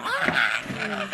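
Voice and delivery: high mock scream of panic; retold scream, high and laughing. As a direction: yelp like a startled mom. Mom screaming at gas leak.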